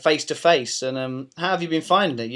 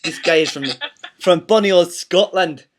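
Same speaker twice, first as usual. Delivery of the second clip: hammed-up Scottish accent, louder and higher on bonny old Scotland; a cheeky stab at a Scottish accent; mock Scottish accent; 4 semitones higher, 7 dB louder than usual. Host teasing his Scottish guest in a hammed-up Scottish accent. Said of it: "I was gonna do an accent".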